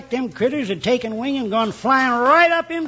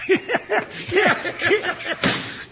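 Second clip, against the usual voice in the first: long high cackle; hearty old cackle; 6 semitones higher than usual. Acted. Rancher cackles over the pork chops. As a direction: laugh at the fleeing deputy.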